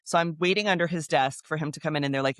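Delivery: ordinary delivery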